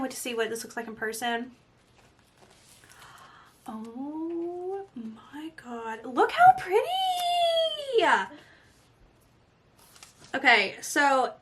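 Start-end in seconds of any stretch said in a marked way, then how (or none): 3.7-6.1 very slow breathy whisper; awed whisper. Real, not acted. Seeing palette in person. 6.1-8.1 much higher, slow shrieking delight; ecstatic squeal. Real, not acted. Seeing palette in person.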